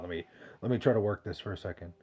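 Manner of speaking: normal talking voice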